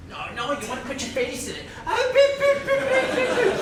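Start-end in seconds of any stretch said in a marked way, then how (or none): 1.9-3.5 squeaky puppy noises, loud and high; high squealing beeps. Pretend. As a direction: squeal the beeps like a giddy dog lover. Excitedly imitating puppy sounds.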